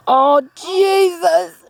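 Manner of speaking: slow, stretched-out groan of dread; real fear, walking past the birds; dreading moan. Real, not acted. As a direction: drag it out, terrified. Forced to walk past birds.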